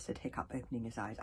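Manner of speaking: usual speaking voice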